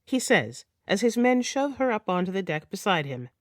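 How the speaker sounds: ordinary delivery, nothing marked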